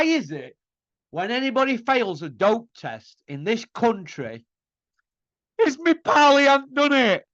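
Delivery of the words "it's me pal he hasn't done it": higher, louder whiny "it's me pal"; mocking excuse. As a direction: parody the pal's defence. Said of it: "mocks excuse of dopers".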